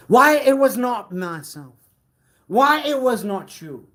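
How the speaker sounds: loud indignant declaration; dramatic outburst. Acted. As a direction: fling it out in protest. Muttered line repeated as outburst.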